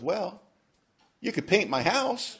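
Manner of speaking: sister's sly reply. Retold or imitated. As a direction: toss it off sweetly, like the catch is obvious.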